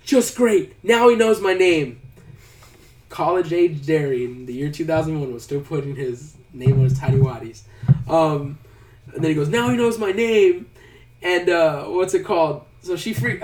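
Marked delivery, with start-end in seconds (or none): 0.0-2.0 loud, higher-pitched mock complaint; retold exasperation. Derry picking up his named underwear, loudly exasperated. 9.5-10.7 higher, louder retold whine; mock freak-out. Derry seeing his name was exposed, mock freaking out. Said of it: "so he's freaking out".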